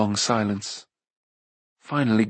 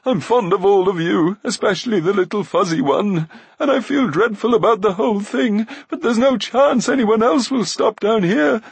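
high, loud whining apology; self-pitying high plea; plaintive Glue; 11 semitones higher, 6 dB louder than usual. A narrator's reading. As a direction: wail plaintively for forgiveness. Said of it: "he added plaintively".

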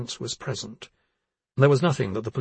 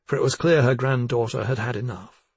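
ordinary delivery, nothing marked

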